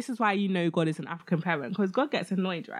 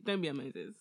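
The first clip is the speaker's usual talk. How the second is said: usual speaking voice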